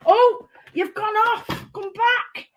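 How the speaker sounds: high, slow squeaky plea to stray paper; mock distress. Crafter pleading with slipping paper, high mock alarm. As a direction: pretend panic at runaway paper. Paper pieces sliding off the table.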